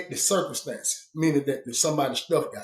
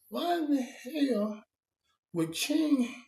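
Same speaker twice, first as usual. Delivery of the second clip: slow, high incredulous why; disbelieving question; 7 semitones higher, 7 dB quieter, much slower than usual. Retold or imitated. Telling how he reacted to news about King, slow high disbelief. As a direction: ask it in slow disbelief, pitched up.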